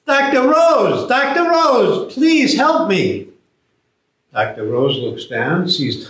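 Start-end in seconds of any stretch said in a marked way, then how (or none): 0.1-3.3 high, louder pleading call; calling up for help. Acted. Man in the ditch begging the doctor, high loud plea. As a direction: stretch it out, begging from below. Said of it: "the next person that passes".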